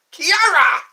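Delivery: high, very loud mock karate cry; karate yell. Playing with a viewer's name, karate yell. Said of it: "That sounds like karate".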